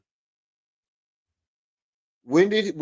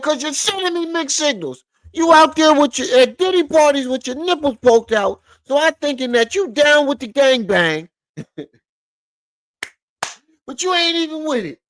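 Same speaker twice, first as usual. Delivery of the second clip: mocking imitation; 10 semitones higher than usual. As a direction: ham up his whiny, heated complaint. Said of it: "He said".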